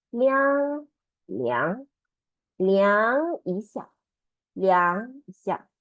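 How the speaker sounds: 量 said liàng, slow and deliberate; rising second tone. Shown as a demonstration. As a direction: say 量一下 slowly, each syllable clear. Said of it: "Li plus ang second tone".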